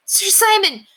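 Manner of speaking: high, loud cry of joy; joyful cry. A narrator's reading. Squire greets revived knight with a high, loud joyful cry. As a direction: squire overjoyed to see him alive. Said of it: "he cried".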